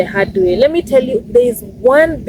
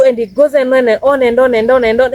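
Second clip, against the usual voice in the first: normal talking voice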